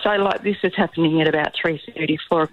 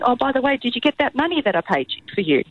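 higher, light and offhand; casual mum; 7 semitones higher, faster than usual. Retold or imitated. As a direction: toss it out in passing. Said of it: "mentions in passing".

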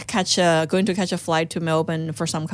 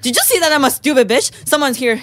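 retold confrontation; 6 semitones higher, faster than usual. Confronting him with phone recording, indignant. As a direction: call him out for witnesses to hear.

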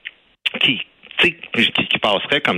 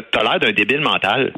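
normal talking voice

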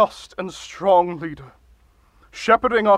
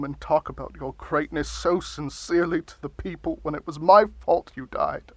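ordinary talk

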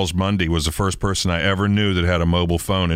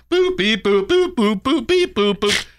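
high, silly beep-boop noises; silly clown beeps; 12 semitones higher, slower than usual. Retold or imitated. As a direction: chirp out the button tones. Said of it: "and I was sitting there".

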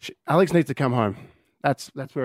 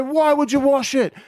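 high, exasperated question thrown back; match the accuser's high pitch; mirrored outraged retort; 12 semitones higher, slower than usual. Retold or imitated. Dad fires question back at daughter, retold exasperation.

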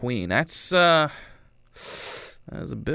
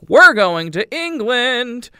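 much higher and louder, slow, thrilled; mock excited announcement; 12 semitones higher, 9 dB louder, slower than usual. Warbucks telling the girls about England, a hammed-up thrilled shout. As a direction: announce it like the best news ever.

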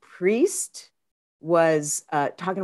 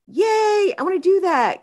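high thrilled cheer; excited yay; 13 semitones higher, 5 dB louder than usual. Retold or imitated. Hearing of a half-price massage package, high excited cheer. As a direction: jump at the massage deal. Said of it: "And I'm like".

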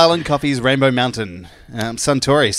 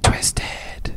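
whispering; 7 dB quieter than usual. Acted. Whispered product name.

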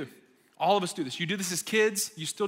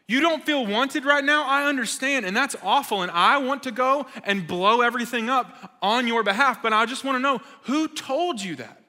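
louder, higher, indignant for him; fierce protective wife, pressing hard; wife defends him with fierce indignation; righteous anger; 6 semitones higher, 4 dB louder than usual. Retold or imitated. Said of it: "righteous anger within her".

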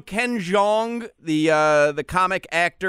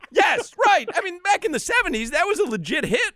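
excited; 12 semitones higher than usual. Real, not acted. Excited agreement about song.

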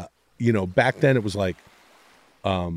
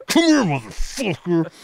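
mock tough guy; 7 semitones higher than usual. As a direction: call him over like a bar-fight tough. Mock threat to lemon fans.

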